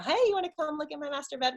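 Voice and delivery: high, quick, chirpy invite; fast, silly come-and-see offer; mock invitation. Acted absurd invitation to guests.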